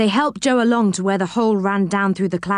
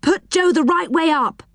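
put-on high-pitched, boyish call; anxious raised-pitch instruction; urgent order; 8 semitones higher than usual. A narrator's reading. Rick calls out an urgent order.

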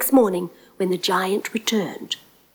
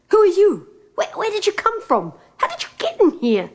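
high, startled questions, faster at the end; startled prince; 12 semitones higher, faster than usual. Acted. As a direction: jump up in alarm at a stranger. Prince wakes, startled by stranger.

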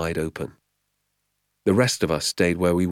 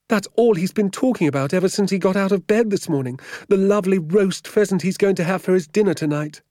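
very high, loud complaining woman; aggrieved vicar's housekeeper; 8 dB louder, faster than usual. A narrator's reading. Woman answering sergeant about the vicar's disappointment, aggrieved. Voices Missus Clipstone.

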